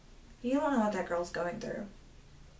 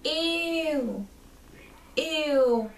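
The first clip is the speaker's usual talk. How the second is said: high mocking disgusted squeal; a mean adult's exaggerated ew of disgust; 6 semitones higher than usual. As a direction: imitate a sneering stranger's ew. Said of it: "I heard behind me go".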